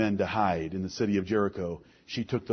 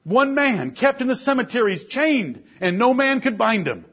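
higher, louder preaching rising through the list; preaching at full force; 8 semitones higher, 6 dB louder than usual. Acted. Preacher rousing the congregation with saved sinners, full force. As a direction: preach it out, each name hitting the congregation. Preacher listing apostles, demoniac.

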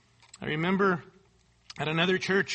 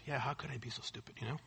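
low, very soft self-reproach; self-introspective; 8 semitones lower, 15 dB quieter, faster than usual. Retold or imitated. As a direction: murmur it inwardly, quick and low. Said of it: "everybody's obviously very self-introspective".

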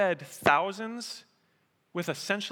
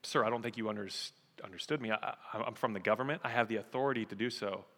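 softer, lower, hesitant official; taken aback; 7 semitones lower, 7 dB quieter, faster than usual. Acted. Government man to a stubborn farmer, taken aback and hesitant. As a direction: stammer politely, quietly thrown. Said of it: "He kinda taken aback".

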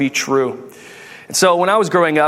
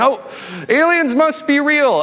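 mock excitement; 10 semitones higher than usual. Internet reacts to alien testimony, mock-excited. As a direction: imitate a gullible internet crowd getting carried away.

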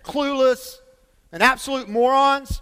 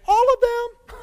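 excited; 13 semitones higher, 3 dB louder, slower than usual. Real, not acted. Playful answer to own question.

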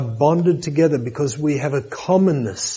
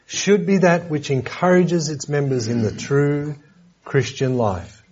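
usual speaking voice

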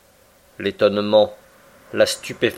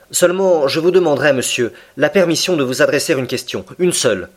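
lightly raised younger gentleman's voice; the professor, polite but firm, pitched up; Aronnax; 6 semitones higher, 3 dB louder than usual. A narrator's reading. (professeur poli, voix un peu plus haute)

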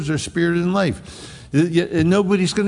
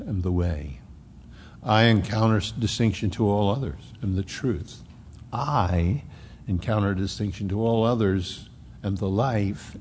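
softer, lower, stately repetition of each clause; emphatic recitation; 5 semitones lower, 4 dB quieter than usual. Acted. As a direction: recite it like a solemn creed, falling at each end. Reciting emphatic translation three times.

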